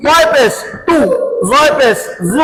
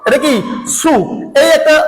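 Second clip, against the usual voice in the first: normal talking voice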